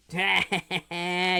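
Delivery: drawn-out mocking cackle; gloating cackle. Streamer taunting a tricked enemy, gloating cackle. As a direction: cackle at the fool you just tricked.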